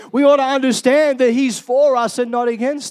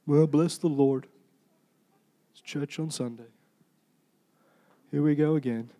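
low, soft, weary monotone; joyless, resigned and subdued; unenthusiastic churchgoer; 8 semitones lower, 8 dB quieter than usual. Acted. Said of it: "we're like this".

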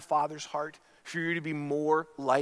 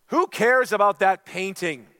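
raised voice of a bidder from the back; mock shout; 5 semitones higher, 9 dB louder than usual. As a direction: call out loud and scornful. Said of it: "shouted a bidder".

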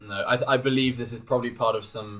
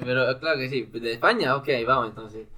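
a hint of a put-on Spanish voice; mock Spanish. A light Spanish accent, joking after the co-host says [name] is Spanish. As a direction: play along lightly in Spanish. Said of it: "From Spain?".

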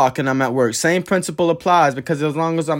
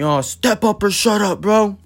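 raised, higher mocking yell; 5 semitones higher than usual. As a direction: throw the challenge at him, raised. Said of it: "starts yelling at me".

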